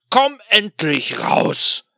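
very loud, slow roar; furious demon tearing the ship apart. Acted. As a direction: bellow it to shake the ship. Demon roars.

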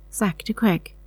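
ordinary delivery, nothing marked

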